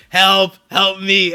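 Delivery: slow mock plea for help. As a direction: stretch out help help me like calling for rescue. Calling out to the nurses for help.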